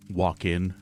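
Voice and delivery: ordinary talk, nothing marked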